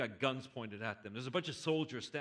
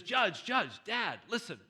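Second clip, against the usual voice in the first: high, loud calling out; urgent call; 7 semitones higher, 4 dB louder, slower than usual. Acted. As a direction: call to him across the courtroom. Son bursts in calling out.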